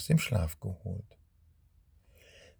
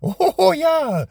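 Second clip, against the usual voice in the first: croaking witch cackle; 2 semitones higher, much slower than usual. Acted. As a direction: gloat like a witch springing her trap. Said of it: "mit krächzender Stimme".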